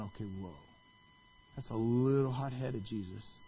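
low, hushed bystander reaction; taken aback. Retold or imitated.

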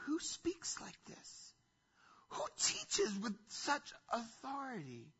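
airy, high, slow whisper of amazement; hushed awe. Acted. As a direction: murmur like a spellbound crowd leaning in. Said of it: "lean in and say".

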